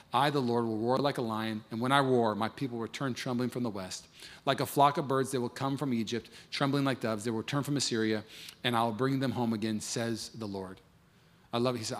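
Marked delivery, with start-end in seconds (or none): 0.1-10.8 low, hushed reading of God's words; soft scripture reading. Acted. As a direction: drop lower and softer, almost reverent. Reads scripture quietly.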